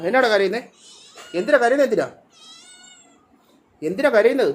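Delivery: high, sing-song pet talk with a laugh; fond, high teasing to a hungry cat; cooing at the cat. Real, not acted. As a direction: coax the crying cat in a lifted voice. Talking to a crying cat.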